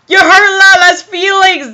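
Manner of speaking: lightly voiced wounded toy character; hurt Teletubby. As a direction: pipe up as a hurt little creature. Teletubbies voiced with puppy-dog faces.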